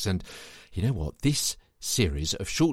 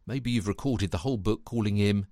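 normal talking voice

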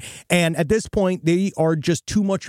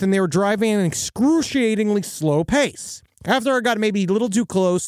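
ordinary talk

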